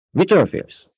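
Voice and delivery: suspicious warrior demanding an answer; sharp question. A narrator's reading. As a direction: snap the question at him sharply. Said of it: "kérdezte éles hangon".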